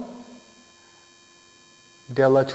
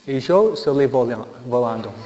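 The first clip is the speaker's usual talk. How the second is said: normal talking voice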